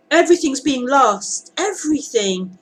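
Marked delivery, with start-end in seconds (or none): none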